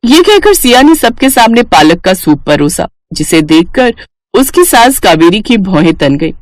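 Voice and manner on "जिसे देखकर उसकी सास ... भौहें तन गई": usual speaking voice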